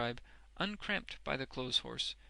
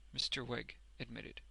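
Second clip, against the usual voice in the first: usual speaking voice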